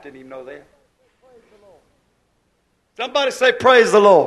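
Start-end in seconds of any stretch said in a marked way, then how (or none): 3.0-4.3 loud preacher's call for a response; rally the congregation to shout back; rousing call-out. Real, not acted.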